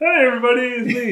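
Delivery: high, loud, over-cheery greeting; hammed-up big-grin hello; mock grinning cheerfulness. Joking about grinning all the time, mock cheerful. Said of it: "walk around with a big grin on your face".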